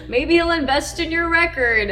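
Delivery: high, loud playful suggestion; imitated boyfriend, brightly egging her on; encouraging boyfriend. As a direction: pitch it up like a teasing pep talk. Boyfriend's quote continues, teasing.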